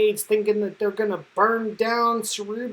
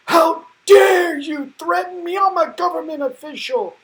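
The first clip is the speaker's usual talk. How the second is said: much higher and louder, offended official; indignant outrage; 8 semitones higher, 7 dB louder than usual. Retold or imitated. Government official snaps back at Cyclops, loud and indignant. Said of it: "I love this guy's indignation".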